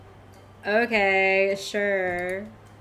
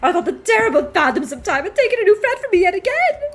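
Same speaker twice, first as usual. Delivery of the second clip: high, loud, sobbing relief; tearful reunion; 5 semitones higher, 8 dB louder than usual. Acted. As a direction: weep with relief at finding him.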